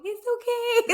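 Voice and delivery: high, crying-laughing it's okay; teary squeak of comfort; tearful reassurance. Retold or imitated. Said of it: "tearing and going".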